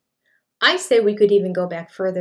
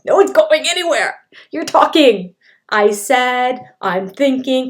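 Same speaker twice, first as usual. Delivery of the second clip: higher, louder scolding; exasperated correction; 4 semitones higher, 8 dB louder than usual. Real, not acted. Speech pathologist scolding Cody's grammar, loud and fed up. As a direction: correct him like a fed-up teacher.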